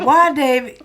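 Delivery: high loud why; imitating herself. Mother asking son why, high and loud. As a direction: raise it up, curious.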